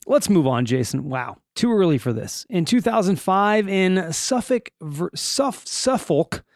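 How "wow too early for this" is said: ordinary talk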